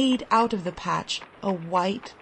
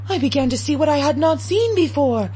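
faster, louder, climbing tension; rising intensity; 7 semitones higher, 6 dB louder, faster than usual. A narrator's reading. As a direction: build to a feverish pitch. Senses overstrained, rising intensity.

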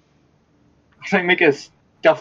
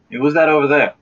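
mildly startled passer-by, raised voice; startled call; 5 semitones higher, 6 dB louder, faster than usual. Acted. Passer-by spots someone hiding and calls out, mildly startled. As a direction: call out louder and higher, lightly startled.

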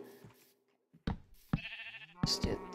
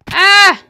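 sudden high loud scream; a real fright scream; startled scream; 8 semitones higher, 17 dB louder than usual. Real, not acted. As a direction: shriek at the jumpscare. Jumpscare in the cave.

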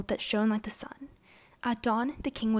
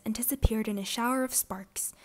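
ordinary delivery, nothing marked

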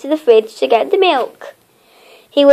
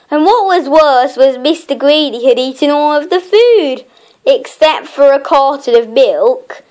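usual speaking voice

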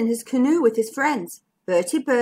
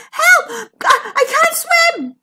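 high, loud gasps of panic; gasping; 6 dB louder, slower than usual. A narrator's reading. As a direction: gasp for help as if drowning. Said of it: "gasped Bertie".

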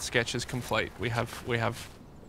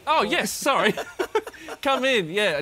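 high, louder, laughing apology; flustered laugh; 7 semitones higher, 4 dB louder, slower than usual. Acted. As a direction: cover the awkward pause with a laugh. Flustered welcome.

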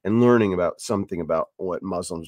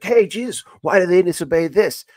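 high, loud, eager dim-witted Pharisee; 10 semitones higher, 7 dB louder than usual. Retold or imitated. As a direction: play a dull Pharisee blurting out a gotcha. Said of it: "And he's like".

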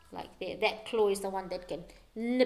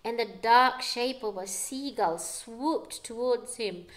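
ordinary delivery, nothing marked

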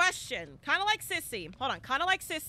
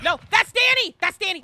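excited correction; 6 semitones higher, 12 dB louder than usual. Real, not acted. Correcting herself in chase.

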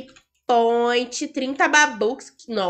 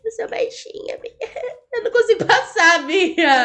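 high whiny mock sob; fake-cry a complaint to your sister; hammed-up tearful whine; 6 semitones higher than usual. Mock crying about failing.

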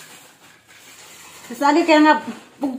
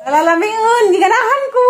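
much higher, louder, ending in a shrieking laugh; squealing tease; 11 semitones higher, 5 dB louder than usual. Pretend. Teasing her companion at home: high squeal into laughter.

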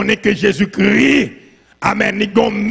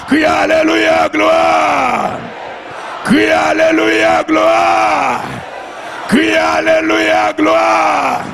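high, sustained chanted shout; praise cry; 9 semitones higher, much slower than usual. Real, not acted. Preacher leading the cry of the saved, chanted shout. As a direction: hold each word long on one raised note. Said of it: "c'est le cri des sauvés".

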